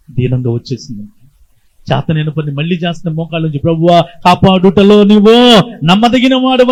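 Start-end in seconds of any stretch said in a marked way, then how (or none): 3.6-6.7 louder and higher, slow pleading prayer; a mother's loud, slow prayer for her son; fervent prayer. Retold or imitated. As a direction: cry the prayer out to God. Widow praying aloud.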